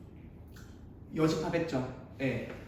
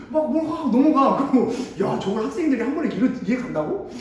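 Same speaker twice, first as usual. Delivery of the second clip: amazed outburst; 4 semitones higher, 8 dB louder than usual. Real, not acted. Amazed at lecture speed.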